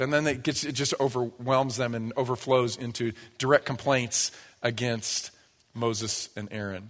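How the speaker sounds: ordinary talk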